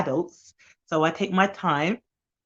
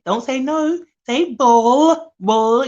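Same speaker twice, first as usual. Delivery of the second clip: louder, higher cheerful praise; encouraging a child; 7 semitones higher, 7 dB louder, much slower than usual. Shown as a demonstration. Nanny praising a toddler's first sound, encouraging. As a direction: coax the child to say ball. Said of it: "take it".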